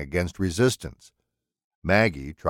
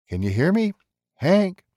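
rising call, louder on the name; 11 semitones higher, 3 dB louder than usual. A narrator's reading. Villain calls the bound man awake, louder.

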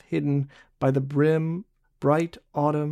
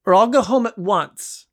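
higher, louder stern warning; firm threat; 6 semitones higher, 4 dB louder than usual. A narrator's reading. Barbe threatens Jean to leave at once, stern. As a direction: warn him off for real. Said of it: "she commanded".

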